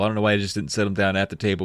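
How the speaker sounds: ordinary talk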